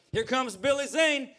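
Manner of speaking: admiring onlookers. Retold or imitated. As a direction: hail two stars coming down the street. Imagined passers-by announcing the two friends.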